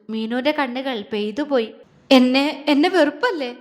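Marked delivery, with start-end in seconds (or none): 2.1-3.6 louder first word, hurt repetition; sobbing question. A narrator's reading. Crying girl asks if he hates her, sobbing. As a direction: burst out with a mild acted sob. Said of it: "അവള് തേങ്ങി".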